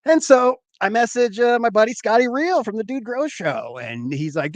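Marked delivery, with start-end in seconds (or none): none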